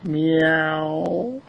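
high, drawn-out cat meow; wailing kitty meow; big meow. A narrator's reading. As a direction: imitate a cat, long and high. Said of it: "gave a big meow".

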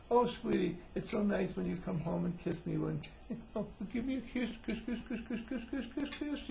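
high, cooing mock sweet-talk; childish wife. Wife pretending to be a child with husband, childish high voice. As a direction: coo it like a little child begging for kisses. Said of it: "pretend that they're little children".

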